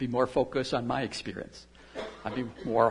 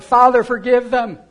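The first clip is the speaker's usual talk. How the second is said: loud, high cry to the Father; shouted plea; 8 semitones higher, 14 dB louder than usual. Retold or imitated. Jesus crying out as nails are driven, loud high plea. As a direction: cry it out at full voice, pleading. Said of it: "he, he shouted".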